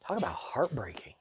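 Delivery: a real whisper, breath only; hushed whisper. Real, not acted. Preacher moved by Hagar's story, whispering. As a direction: let it slip out, barely voiced.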